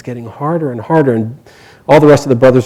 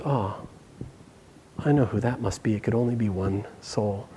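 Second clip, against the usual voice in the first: hushed recognition; 5 semitones lower, 17 dB quieter than usual. A narrator's reading. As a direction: murmur it low, as if musing to yourself. King quietly recognizes who it is.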